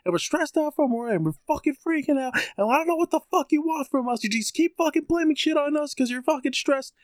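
very high, mock-screamed tirade at moderate volume; retold screaming. Tenant retelling the rant at the landlady, mock screaming. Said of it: "I was just, like, screaming".

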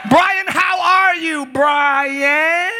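high, slow, stretched greeting; hammed-up intoned hello; prayer voice in conversation. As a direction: greet a friend like an overblown prayer. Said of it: "Who talks like that?".

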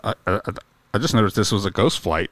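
lightly voiced pilot on the radio; pilot radio call. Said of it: "Tower, uh, just be aware".

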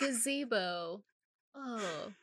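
soft, slow, drawn-out coo; imitated cooing. Co-host repeating the cooed name for laughs, softly cooed. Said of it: "cooing his name".

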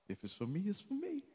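ordinary talk, nothing marked

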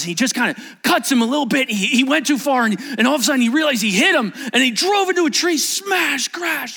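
high, loud, urgent storytelling; building crash narration. A narrator's reading. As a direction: race up to the impact, loud and high. Tells the crash at full force.

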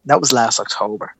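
usual speaking voice

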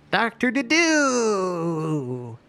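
high, long drawn-out hail; booming call. Acted. Wizard booming his greeting toward a distant hut, drawn-out call. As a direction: call it out on a long, sustained last syllable. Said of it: "make his voice boom a little bit".

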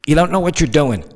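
ordinary talk

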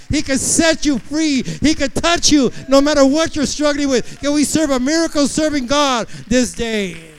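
loud, high fiery preaching; preaching at full force. Acted. Pastor testifying to the congregation at full force. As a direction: preach it out to the rafters.

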